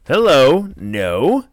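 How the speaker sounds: loud, high, swooping refusal; imitated indignant interruption; mock objection. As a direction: butt in with a hammed-up no. Retold girlfriend's interruption.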